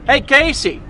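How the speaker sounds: loud high call into an empty building. Pretend. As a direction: yell the name out. Calling out into the warehouse.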